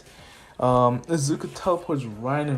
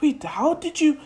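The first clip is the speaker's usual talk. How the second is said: higher, startled question; surprised girl; 13 semitones higher than usual. Acted. Uraraka amazed she was teleported, higher startled voice. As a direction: ask it caught off guard.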